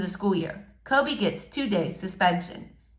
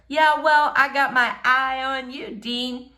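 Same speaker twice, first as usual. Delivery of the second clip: hollered comeback; 5 semitones higher than usual. A narrator's reading. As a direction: holler it back at the bully.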